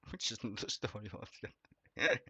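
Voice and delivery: amused aside, laughing. Real, not acted. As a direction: chuckle the words out. Laughing aside about Murdoch.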